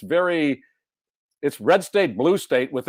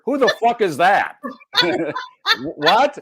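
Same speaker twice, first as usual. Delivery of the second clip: loud, high-pitched bewildered teacher; high, loud outraged teacher voice; improv teacher; 8 semitones higher, 4 dB louder, slower than usual. Acted. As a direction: blurt it like an incredulous improv teacher. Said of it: "I can do my improv teacher".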